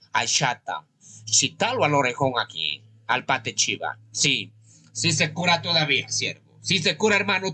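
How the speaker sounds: ordinary delivery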